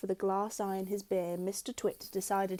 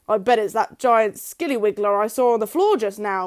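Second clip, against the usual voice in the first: louder, sly Mister Twit voice; sly husband; 11 dB louder than usual. A narrator's reading. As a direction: tease her with a scary tale. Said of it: "Mister Twit said".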